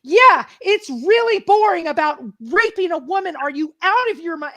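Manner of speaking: loud, high furious sarcasm; snapping back in real anger; angry outburst. Retold or imitated. Telling the man off in the elevator, furious sarcastic shout. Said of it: "it was already bubbling up".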